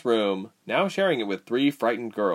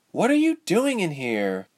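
high-pitched, perturbed question; put-out character voice; 9 semitones higher than usual. A narrator's reading. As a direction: demand an explanation, voice raised in pitch.

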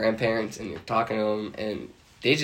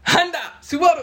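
high loud mock Japanese; car brands as fake Japanese; 5 dB louder than usual. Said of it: "pretending to speak Japanese".

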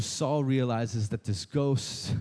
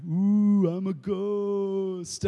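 higher, slow spooky ghost wail; mock ghost voice; 4 semitones higher, much slower than usual. Preacher mocking a con ghost for the congregation, slow spooky wail. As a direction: ham up a fake ghost moaning from backstage. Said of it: "somebody in the back being like".

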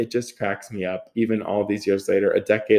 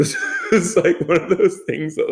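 laughing; 9 semitones higher than usual. Real, not acted. Laughing while telling.

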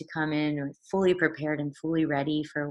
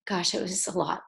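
usual speaking voice

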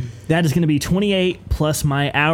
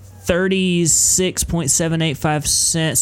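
slow lawyer reading; much slower than usual. Pretend. Lawyer slowly reading amounts.

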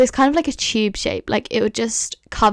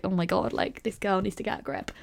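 muttered thought; 2 semitones lower, 10 dB quieter than usual. Retold or imitated. Imagined thought of nurse.

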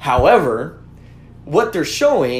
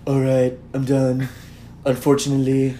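lightly voiced Trump, Baldwin-style; a hint of a sulking president; Donald Trump impression; 3 semitones lower than usual. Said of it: "the best Alec Baldwin impression of Don".

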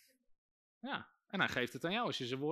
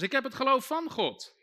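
higher and louder, for all to repeat; louder call-out; 9 semitones higher, 8 dB louder than usual. Shown as a demonstration. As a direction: roep het hoog en luid voor. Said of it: "Zeg nog eens". (luid en hoog voorgezegd, gemeente zegt na)

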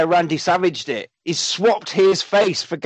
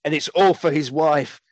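ordinary delivery

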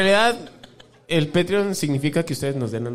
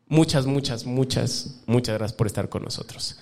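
soft and fading, sincere; sincere thank you, trailing off softer; heartfelt thanks; 7 semitones lower, 5 dB quieter than usual. Real, not acted. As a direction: let each muchas drop softer.

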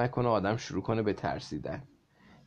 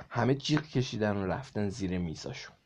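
plain reading voice

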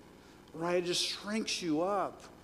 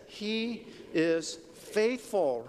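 very slow, higher-pitched say-along; say-along recital; 4 semitones higher, much slower than usual. Shown as a demonstration. Preacher has the congregation repeat the verse, drawn-out say-along. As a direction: draw out each word of HE IS FAITHFUL. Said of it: "Let's say".

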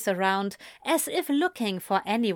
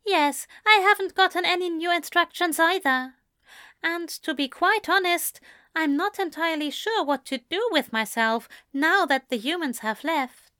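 higher angel voice, politely at a loss; prim angel, uncertain; 10 semitones higher than usual. Acted. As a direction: confide mild aimlessness as a proper angel. Voices Aziraphale in dialogue.

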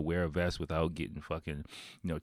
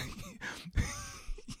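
laughing; 9 dB quieter than usual. Real, not acted. Breaks up laughing.

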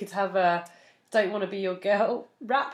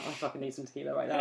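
ordinary delivery

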